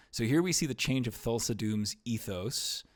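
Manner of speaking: ordinary talk, nothing marked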